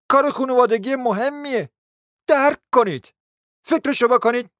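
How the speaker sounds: put-on high, insistent young relative appealing; pleading nephew. Nephew urging his uncle to think, pleading insistently.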